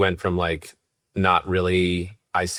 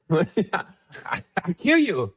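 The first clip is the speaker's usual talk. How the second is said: laughing; 11 semitones higher, much slower than usual. Real, not acted. Laughing at praise for his accent.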